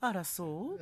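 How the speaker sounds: a light, laughing take on the captain's gentle reply; mock Nagumo voice. Co-host imitating Nagumo's gentle line, lightly laughing. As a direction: toss off "あら、そう？" like a breezy lady captain. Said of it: "みたいな".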